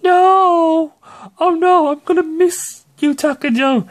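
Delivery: high, loud hammed-up wail of loss; hammed-up high cry of grief; mock grief. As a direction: overact a sob over a lost ally. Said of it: "And it's like".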